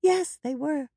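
put-on high child's agreement; high-pitched, keen younger sister; eager little girl. A narrator's reading.

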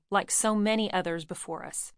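ordinary delivery, nothing marked

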